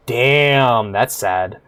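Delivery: ordinary talk, nothing marked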